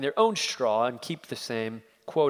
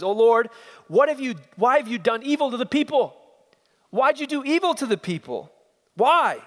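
higher, louder anguished cry to God; grieving protest; 9 semitones higher, 6 dB louder than usual. Acted. Moses crying out to God in grief and protest. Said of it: "Emotion, grief".

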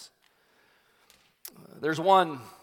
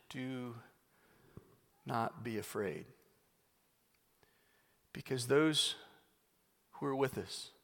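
soft, lower, unhurried calm; steady him with quiet confidence; calm reassurance; 3 semitones lower, 9 dB quieter than usual. Retold or imitated. Elisha reassures the servant, softly and calmly. Prophet comforts panicked servant.

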